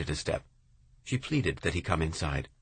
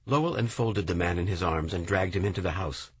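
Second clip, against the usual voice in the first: usual speaking voice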